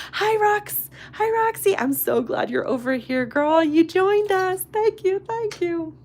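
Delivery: bright high welcome; excited greeting. Real, not acted. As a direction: greet a friend who just arrived. Greeting a joining viewer.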